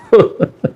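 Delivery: brief unvoiced laugh; an amused breathy chuckle. Real, not acted. Laugh at the boys crossing.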